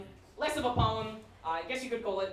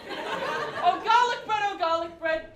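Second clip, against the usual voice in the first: higher, louder swooning ode; passionate cry; 9 semitones higher, 8 dB louder, slower than usual. Acted. Poet declaring love to garlic bread, hammed-up passionate cry. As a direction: cry it out again with longing.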